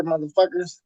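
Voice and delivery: usual speaking voice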